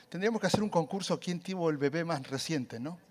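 ordinary delivery

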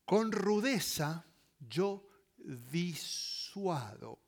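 normal talking voice

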